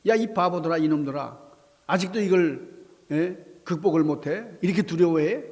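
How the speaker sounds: 높은 톤으로 몰아세우듯 야단치기; 바보들이라며 다그치는 호통; 꾸짖음. Acted. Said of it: "이렇게 꾸짖는 것 같지만은". (야단치는 말투)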